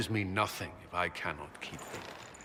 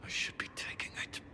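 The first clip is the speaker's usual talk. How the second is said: anguished whisper to himself; 9 dB quieter than usual. Acted. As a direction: breathe the words out with no voice. Repeats line under his breath.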